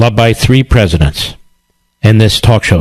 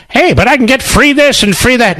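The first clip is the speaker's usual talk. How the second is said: pitched way up, mocking a freeloader; mock whiny voter; 13 semitones higher than usual. Host mocking Democrat voters, high mocking whine. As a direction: sneer it like a greedy voter.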